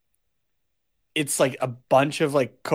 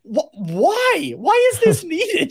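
high, slow incredulous whine; whining at the absurdity; exasperated disbelief; slower than usual. Retold or imitated. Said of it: "I'm like".

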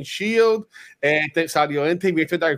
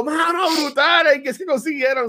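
thrilled reaction; 8 semitones higher than usual. Retold or imitated. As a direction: relive your amazement at great news. Retells excited message to Ricky.

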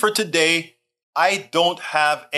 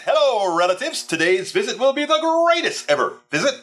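hammed-up greeting; 8 semitones higher than usual. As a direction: read it out like a grand, excited announcement. Reads viewer's greeting out theatrically.

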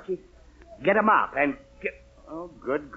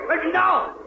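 exasperated outburst; 11 semitones higher than usual. Acted. Father interrupted, exasperated.

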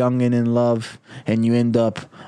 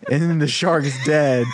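ordinary delivery

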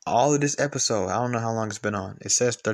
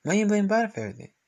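higher, fast pressing question; exasperated repeat; 9 semitones higher, much faster than usual. Real, not acted. Pressing the Biden defenders, higher and fast. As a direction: push it up, exasperated. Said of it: "repeats higher".

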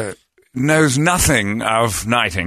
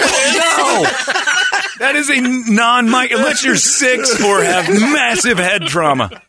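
laughing protest; 11 semitones higher, slower than usual. Real, not acted.

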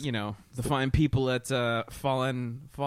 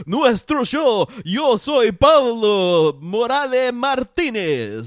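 announcer shout; 8 semitones higher, 10 dB louder than usual. Acted. Show intro announcement.

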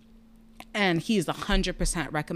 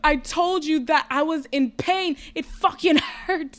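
raised, high mock yell at the doctor; half-shouting it back at him, pitched up; retold scream; 9 semitones higher, 6 dB louder, slower than usual. Patient yelling at the doctor she warned, mock yell. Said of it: "I just screamed".

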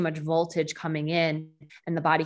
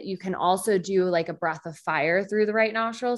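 normal talking voice

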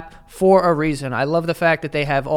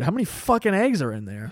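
usual speaking voice

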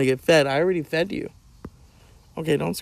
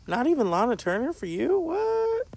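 cooing at the cat; 11 semitones higher, 7 dB quieter than usual. Acted.

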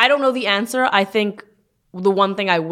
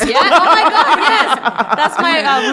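excited laughing; 8 semitones higher, 6 dB louder, much slower than usual. Real, not acted. Excited recognition of restaurant.

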